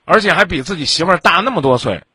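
ordinary delivery

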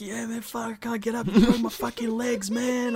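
injured guy voiced. Crushed player telling the driver his legs are broken, mock strain.

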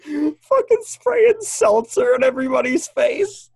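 high, loud, slow clown cackle talk; manic clown voice. Acted. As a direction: stay in the shrill clown act. Clown act continues.

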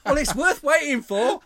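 loud, high, drawn-out mock boast; teasing imitation. Host imitating co-host boasting to the girls, teasing. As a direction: imitate him loudly and slowly, cracking up. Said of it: "And you're saying".

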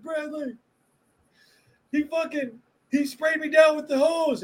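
put-on high whiny voice of a kid telling on someone; mock tattletale, pitched well up; whiny tattling kid. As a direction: kid complaining to the boss Bradley about the hose, whiny and high.

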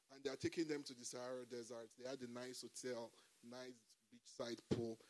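usual speaking voice

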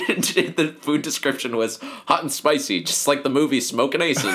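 talking through laughter; giggling while telling it; laughing. Real, not acted. Laughing through a funny memory.

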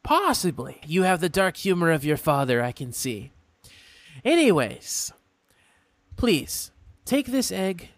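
a polite, curious imp stranger; imp folk voice. Acted.

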